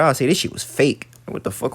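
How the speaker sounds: ordinary talk, nothing marked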